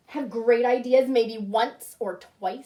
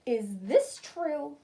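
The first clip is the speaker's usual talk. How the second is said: slow, stern question; cross mother, slowly demanding; 3 semitones higher, much slower than usual. Acted. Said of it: "She only calls me young man if she's very mad".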